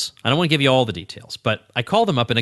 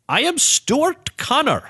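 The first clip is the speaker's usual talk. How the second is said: slow, high put-on caller voice; imitated scam caller; 13 semitones higher, slower than usual. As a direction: announce a dubious name slowly, pitched way up. Imitating scam caller giving false name.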